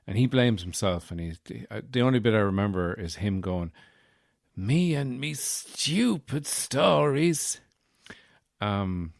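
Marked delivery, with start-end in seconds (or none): 4.6-7.6 high, slow Dublin lament; hammed-up Dublin self-blame, swooping high; mock Dublin accent. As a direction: drag out each word in a put-on Dublin accent. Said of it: "'cause he's a dub".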